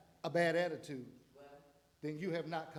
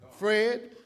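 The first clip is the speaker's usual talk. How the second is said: God speaking; 3 semitones lower, 8 dB louder, slower than usual. Retold or imitated. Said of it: "He said".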